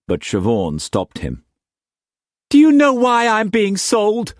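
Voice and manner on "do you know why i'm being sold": high, louder sudden outburst; young woman bursting out at her uncle. A narrator's reading. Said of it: "She burst out".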